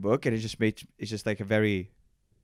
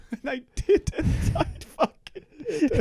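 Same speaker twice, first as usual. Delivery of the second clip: laughing mock despair; slower than usual.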